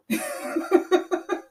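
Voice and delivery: high, bright laugh at herself; amused laugh. Real, not acted. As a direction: laugh off her own accent attempt. Laughs at own Jamaican accent.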